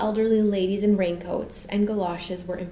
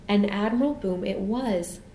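ordinary delivery

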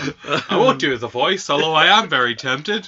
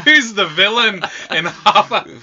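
laughing; 4 semitones higher, 5 dB louder than usual. Real, not acted. Laughing question.

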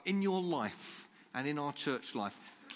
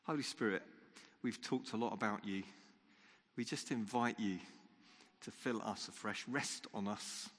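quiet prayer; 3 semitones lower, 5 dB quieter than usual. Acted. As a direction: pray gently, almost under the breath. Leads a quiet closing prayer.

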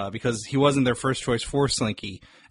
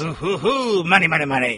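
villain voice; 3 semitones higher, 3 dB louder than usual. Retold or imitated. Quoting Hamm's villain line.

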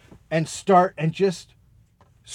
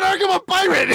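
mock pirate yell; 12 semitones higher than usual. Comedian retelling what he said at the audition, mock pirate yell. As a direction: blurt the pirate line up high. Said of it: "I just yelled something".

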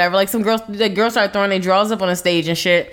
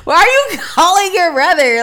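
laughing; 9 semitones higher, 5 dB louder than usual. Real, not acted. Mocking, laughing incredulity.

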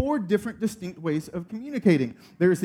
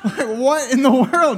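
louder, slow and higher, laughing; laughing disbelief; 7 semitones higher, 9 dB louder, slower than usual. Retold or imitated. Dad telling the congregation, a slow laughing what-in-the-world.

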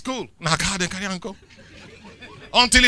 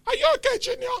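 high, sing-song doting mother voice; fussing mother; faster than usual. Retold or imitated. Preacher imitating a doting mother asking her child, high and cooing. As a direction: coo it like an anxious mom.

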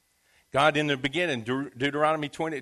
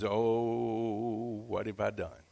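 trembling dismay; 6 semitones lower, 8 dB quieter, much slower than usual. Acted. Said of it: "Isaac trembled violently".

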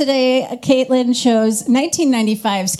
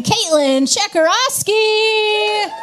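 announcer; 9 semitones higher, much slower than usual. Acted. Host announcing guest.

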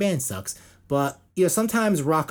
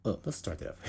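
normal talking voice

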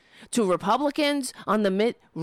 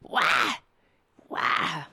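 mock old lady squawk; 5 semitones lower than usual. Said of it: "Gonna be an old lady, like".

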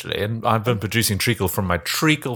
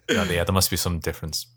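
ordinary talk

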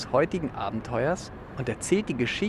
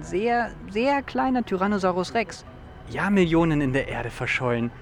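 eager, pitched-up enthusiasm; excited; 6 semitones higher, 4 dB louder than usual. Acted. Amateur fossil hunter boasting of a find, excited. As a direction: build to a proud, louder claim.

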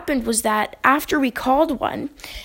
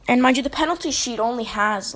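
ordinary talk, nothing marked

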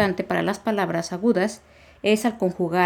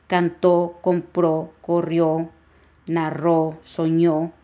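each of "cantó, compró, corrió, narró, soñó" hit on its final ó; spaced-out list, "cantó, compró, corrió, narró, soñó" each end-stressed; much slower than usual. Shown as a demonstration. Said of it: "va a llevar acento en la O".

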